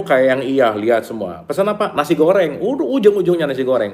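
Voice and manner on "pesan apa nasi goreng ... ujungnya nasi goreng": mock excited. Acting out ordering food.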